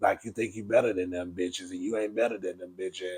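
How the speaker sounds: very low, soft, droning mockery; deep mock voice, endings dragged out; box-fan voice. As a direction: imitate a man talking into a fan. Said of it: "That's how he sound".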